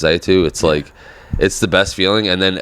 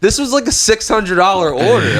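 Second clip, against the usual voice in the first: outraged complaint; 14 semitones higher, slower than usual. Retold or imitated. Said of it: "I was just like".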